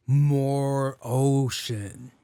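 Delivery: very slow, stretched-out reveal; dramatic reveal. Retold or imitated. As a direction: drag both words out in dread.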